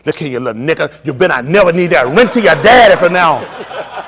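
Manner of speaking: a loud mock scolding of the kids; imitating his wife. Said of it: "This how she is".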